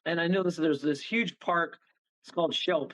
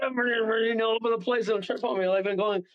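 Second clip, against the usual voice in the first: ordinary talk, nothing marked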